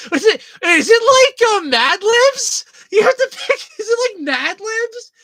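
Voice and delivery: high, loud words squeezed through real laughter; laughing too hard to get the question out. Real, not acted. Asking the chat through real laughter, high and loud.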